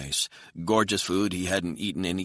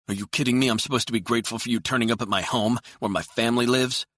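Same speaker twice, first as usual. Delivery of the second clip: higher, louder indignant protest; angry outburst; 7 semitones higher, 5 dB louder, faster than usual. A narrator's reading. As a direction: protest angrily, voice raised high. Angry quarrel over family visit.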